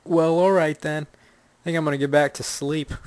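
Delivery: half-asleep reply to bad news; sleepy brush-off. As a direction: shrug it off and drift back to sleep. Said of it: "and you yawn".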